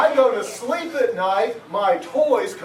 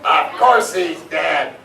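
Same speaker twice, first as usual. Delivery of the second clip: put-on slow, lower, grumpy old elf voice; deep raspy nasally voice; 4 semitones lower, 4 dB louder, slower than usual. Old elf in the tree scoffs at the boy, in a deep grumbling voice. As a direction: growl it like a cranky tiny old elf. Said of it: "a deep, raspy, nasally voice".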